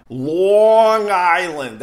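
"Long Island" drawn out slowly in thick Long Island accent. Shown as a demonstration. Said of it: "That's how we say it".

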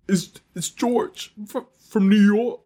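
halting, high-pitched fake New York voice; slow, stumbling prank caller with a put-on New York accent; silly high New York accent; weird New York accent. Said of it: "a, a weird accent".

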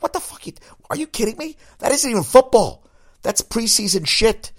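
high, loud, outraged "are you kidding me"; outraged disbelief. Retold or imitated. Telling his friend Rock the preseason game is a joke, outraged. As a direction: sound appalled at a preseason ticket. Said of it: "I was like".

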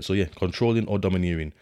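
usual speaking voice

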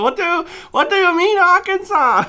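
very high, loud, incredulous mock squeal; meme girl voice. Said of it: "When she go".

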